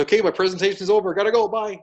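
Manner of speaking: high, rushed mock goodbye; hasty exit. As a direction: hurry off, imitating a flustered presenter. Imitates presenter fleeing the scene.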